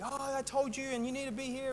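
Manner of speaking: a touch of irritated mum nagging; retold mum, only mildly cross; angry mum. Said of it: "she starts getting angry".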